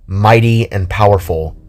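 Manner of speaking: ordinary talk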